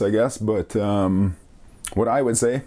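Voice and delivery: normal talking voice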